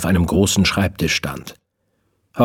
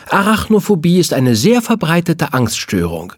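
know-it-all lecture, a touch higher; lecturing; 9 semitones higher than usual. Acted. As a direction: lecture him like a little professor. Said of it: "dozierte Fiona".

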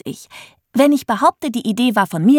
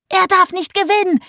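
put-on determined girl; 10 semitones higher than usual. A narrator's reading. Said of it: "sagte Millie".

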